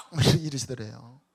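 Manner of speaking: ordinary talk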